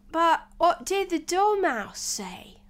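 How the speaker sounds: high, loud, slow question; questioning juror. Acted. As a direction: ask it slowly in a high juror's voice.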